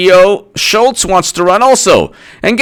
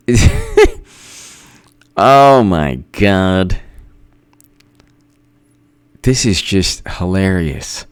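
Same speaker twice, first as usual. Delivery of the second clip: laughing; 10 semitones lower, 5 dB quieter, much slower than usual. Real, not acted. Cracking up at a tweet.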